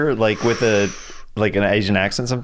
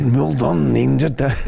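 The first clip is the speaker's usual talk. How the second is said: put-on Spanish accent; slower than usual. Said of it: "what accent I just did".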